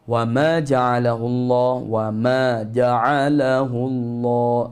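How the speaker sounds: ja'alahu drawn out slowly; slow drill. Shown as a demonstration. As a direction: stretch the ma two counts. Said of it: "Ma tu panjang dua harakat".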